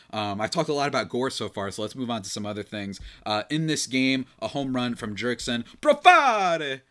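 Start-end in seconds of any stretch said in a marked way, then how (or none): 5.8-6.8 PROFARE drawn out, high and loud on the middle syllable; big stretched announcer-style "Profare"; signature name call. Shown as a demonstration. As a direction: belt out "Profare" with a long soaring FAR. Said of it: "That's how I like saying his name".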